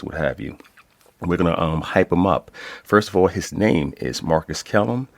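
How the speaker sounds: ordinary talk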